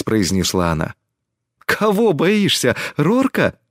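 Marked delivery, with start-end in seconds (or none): 1.6-3.6 high-pitched, drawn-out astonishment; surprised question. A narrator's reading. Friend questioning her fear, surprised. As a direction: ask slowly, voice lifted in disbelief. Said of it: "удивлённо спросила Тесми".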